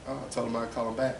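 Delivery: normal talking voice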